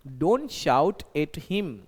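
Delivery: very slow, each word laid out for the class; slow model sentence. Shown as a demonstration. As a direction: dictate it slowly like a teacher. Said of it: "वो चिल्लाइए मत". (बहुत धीरे, साफ़-साफ़)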